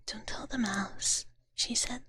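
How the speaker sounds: ordinary delivery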